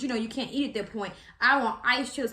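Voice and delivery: ordinary delivery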